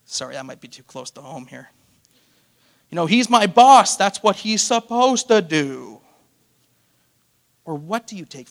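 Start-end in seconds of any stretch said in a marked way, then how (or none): 2.9-6.0 entitled shrug. Retold or imitated. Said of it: "taking someone for granted".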